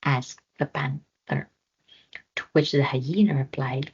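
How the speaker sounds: usual speaking voice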